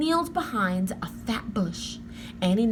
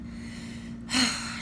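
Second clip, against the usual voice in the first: weary sigh; 3 semitones higher, 8 dB quieter than usual. A narrator's reading. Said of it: "Jack sighed".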